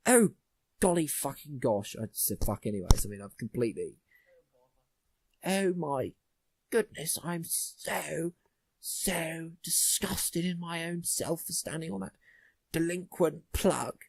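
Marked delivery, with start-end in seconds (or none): 0.1-2.0 exaggerated posh British exclamation, drawn out; mock posh. Shown as a demonstration. Posh person stubbing a toe, hammed-up posh accent. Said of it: "people being posh". 5.4-14.0 slow, higher, hammed-up posh British accent; mock posh. Shown as a demonstration. Posh person who stood on a plug, exaggerated posh accent. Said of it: "people being posh".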